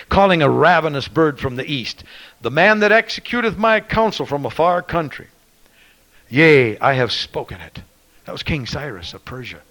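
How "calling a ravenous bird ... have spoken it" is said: loud scripture quote building to a cry; impassioned revival preaching; preaching at full force. Acted. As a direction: lift the verse and hammer it home. Preacher quoting scripture, rising.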